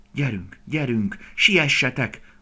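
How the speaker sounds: loud, high, stretched-out urging; urgent urging. A narrator's reading. Young deer willing the humans to hurry, loud urging. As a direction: spur them on impatiently.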